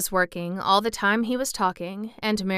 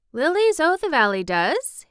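put-on louder, higher Yorkshire boy; country lad; 8 semitones higher, 6 dB louder than usual. Boy answering about bell flowers, keen. Dickon answers keenly in story.

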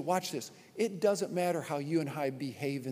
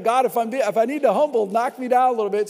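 loud, quick plea to God, half laughing; half-joking prayer; 6 semitones higher, 10 dB louder, faster than usual. Retold or imitated. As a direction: pray it fast, playfully begging to be humbled. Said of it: "I was like".

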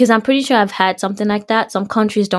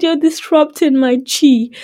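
higher, drawn-out theatrical complaint; mock exasperation; 6 semitones higher, 3 dB louder, slower than usual. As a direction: whine it slowly at mom, playfully overdone. Repeating her retort to mom.

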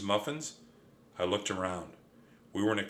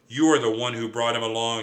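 fast, louder accusing yell; 6 dB louder, faster than usual. Acted. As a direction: blame your brother sharply. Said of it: "she yelled at me".

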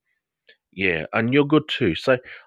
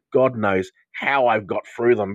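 ordinary talk, nothing marked

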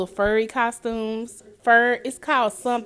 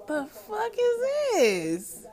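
disbelief; 11 semitones higher, 4 dB quieter, much slower than usual. Retold or imitated. Quoting own reaction.

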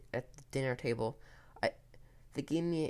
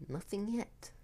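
higher, lilting teasing tone; giggly menace; 8 semitones higher, 4 dB quieter than usual. A narrator's reading. Jasmine toying with Ethan about his brother, lightly giggly tease. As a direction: toy with the listener, lightly singsong. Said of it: "Jasmine giggled".

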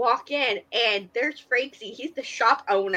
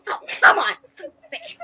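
higher, louder exasperated whine; mock exasperated cry; frustrated whine; 4 dB louder, faster than usual. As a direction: plead then curse in mock frustration. Frustrated plea, fleeing.

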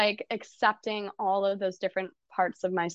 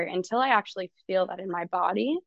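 ordinary talk, nothing marked

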